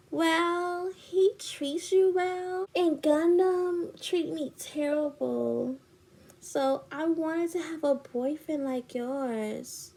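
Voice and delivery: high, slow put-on whine; imitated self-justifying friend. Friend explains why she wants him, mock whiny. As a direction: mimic a friend excusing herself sweetly.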